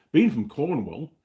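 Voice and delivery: badly faked Cornish accent, drawn out and lifted; terrible Cornish accent. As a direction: have a half-hearted go at a Cornish accent. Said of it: "that was a terrible accent".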